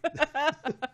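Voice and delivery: high-pitched laugh; helpless high giggle; real laugh. Real, not acted. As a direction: crack up at the joke. Laughs at joke about Engineer Mike.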